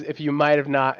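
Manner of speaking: normal talking voice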